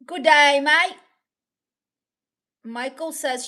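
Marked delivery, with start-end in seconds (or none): 0.1-1.0 put-on loud, high 'G'day mate' accent; hammed-up Australian accent. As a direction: belt out a caricature Aussie greeting. Accent put on after accent talk.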